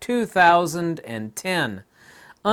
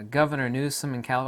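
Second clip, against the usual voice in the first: usual speaking voice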